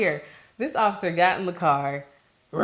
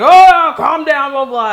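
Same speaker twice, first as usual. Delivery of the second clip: loud, higher brush-off as the officer; dismissive cop; 9 semitones higher, 12 dB louder, slower than usual. Retold or imitated. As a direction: wave her off like an annoyed cop. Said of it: "And so the cop's like".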